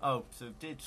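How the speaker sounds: usual speaking voice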